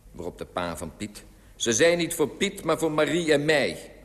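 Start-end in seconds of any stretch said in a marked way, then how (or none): 1.6-3.9 loud, higher-pitched explanation; raised, urgent voice; calling up. A narrator's reading. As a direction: insist loudly up to the window. Father shouts up to the window.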